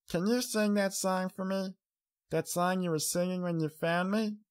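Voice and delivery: put-on high little-girl request; small child asking sweetly; young filly, pleading. A narrator's reading. Child character asks.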